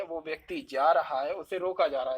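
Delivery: ordinary talk, nothing marked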